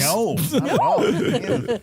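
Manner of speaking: loud, high, laughing denial. Real, not acted. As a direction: protest through a laugh.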